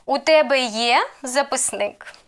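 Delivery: є swept upward, записник falling; question intonation. Shown as a demonstration. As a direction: ask it by lifting є. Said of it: "the main role plays intonation".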